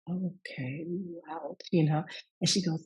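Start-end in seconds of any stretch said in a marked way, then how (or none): 0.1-1.6 soft, breathy whisper of surprise; whispered aside. Retold or imitated. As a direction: whisper it to yourself, amazed. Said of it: "I'm like".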